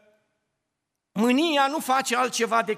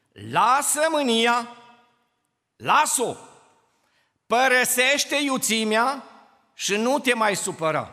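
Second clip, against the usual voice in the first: preaching scripture; 4 semitones higher, 6 dB louder than usual. Acted. Preacher to congregation, loud emphatic scripture. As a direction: proclaim the verse with force.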